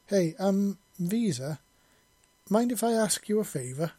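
hesitant, a little nervous ask; slightly nervous. Acted. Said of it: "in a slightly nervous voice".

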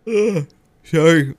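talking through a yawn. Real, not acted. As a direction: yawn mid-word, then apologise. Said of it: "I keep yawning".

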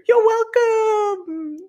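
high, slow, drawn-out sing-song; milk the punchline; a teasing, self-satisfied bow; smug you're welcome. Acted. Smug joke sign-off.

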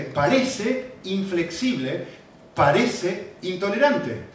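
parece stretched slowly, falling; slow emphatic parece. Shown as a demonstration. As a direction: lean slowly on each parece. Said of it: "el énfasis está en parece".

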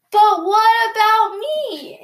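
imitated toddler protest, slow and high; pleading toddler. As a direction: plead like a toddler losing his candy. Toddler pleads for his candy.

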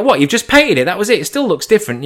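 high, mildly incredulous questioning. Acted. Someone questions the renovation story, mildly incredulous.

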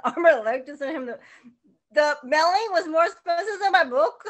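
loud, high, laughing complaint. Real, not acted. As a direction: laugh through the disbelief. Laughing over costly mailing.

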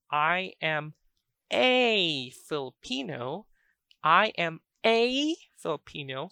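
"a" stretched and loud in "I am a Filipino"; stress on A. Shown as a demonstration. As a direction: punch and hold the word "a", slowly. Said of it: "so a or an is a determiner".

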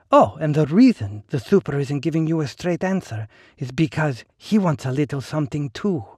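high, silky and knowing; smooth woman's voice. Acted. Ligny character voice.

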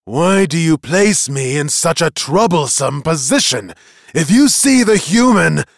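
loud, indignant magician; heated, forceful reproach; angry scolding. A narrator's reading. Angry magician confronts boy.

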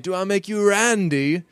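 high, slow, bad Austin Powers English; awful English accent. Quoting Austin Powers to a puzzled co-host, awful English accent. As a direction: put on a hammy, badly faked English accent. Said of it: "the awful English accent I just did".

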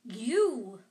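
loud, furious outburst. A narrator's reading. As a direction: burst out angrily on one loud word. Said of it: "said Ron furiously".

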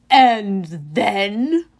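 loud, slow broken-English giant; a gloating brute showing off his prize; booming Cyclops. A narrator's reading. Cyclops bragging to Grover, loud and slow.

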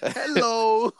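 mock cheerful greeting; hello voice. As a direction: imitate the friend's greeting. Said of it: "that's my hello voice".

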